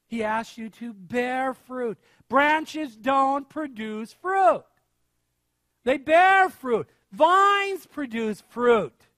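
"bear" and "Vines" stretched and punched; slow, forceful preaching, hammering "bear" against "produce"; emphatic contrast. Real, not acted. Preacher correcting believers on fruit, "bear" stressed hard.

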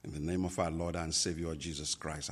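ordinary talk

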